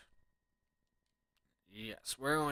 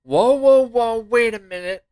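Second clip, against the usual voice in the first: loud panicked whoa; alarmed shout; 11 semitones higher, 17 dB louder, slower than usual. Real, not acted. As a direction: shout to stop it. Boss threatens.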